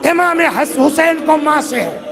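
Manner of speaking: high, sustained majlis lament; mourning lament. Real, not acted. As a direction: preach the tragedy in a high, grief-laden wail. Climax of mourning recitation. (نوحہ خوانی جیسا بلند، دردناک بیان)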